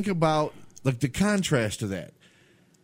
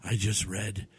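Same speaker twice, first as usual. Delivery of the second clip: Steven Seagal; 4 semitones lower, 6 dB quieter, slower than usual. Retold or imitated. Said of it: "Steven Seagal says".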